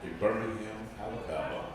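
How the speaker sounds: deep calm documentary narration; Morgan Freeman impression. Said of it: "that's my Morgan Freeman impression".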